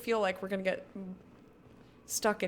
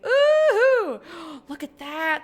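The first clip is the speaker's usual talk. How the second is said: excited squeal; 8 semitones higher, 11 dB louder, slower than usual. Real, not acted. Excited gasp at captain's quarters.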